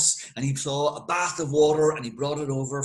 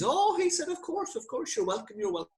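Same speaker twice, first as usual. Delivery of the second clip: high sugary "of course, of course"; fawning relief; 13 semitones higher, 3 dB quieter than usual. Acted. Doyle seeing the police off, high and fawning. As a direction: pitch way up, all innocent and obliging.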